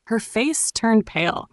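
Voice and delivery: plain reading voice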